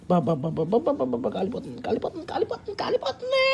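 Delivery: hushed babble climbing to a high squeal; mouth burning. Real, not acted. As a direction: yelp softly through scalding hot food, rising. Mouth burning on hot food. (నోరు కాలిపోతున్నట్టు సన్నటి గొంతుతో)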